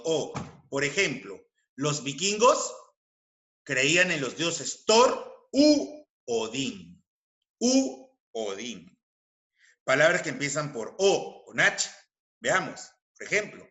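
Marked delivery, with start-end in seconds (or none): 4.8-9.0 "u" drawn out as a long "oo"; stress on "u". Shown as a demonstration. Teacher showing o changing to u, "u" held long. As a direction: slowly set "u" apart before "Odín". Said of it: "Cambia a u para evitar la cacofonía".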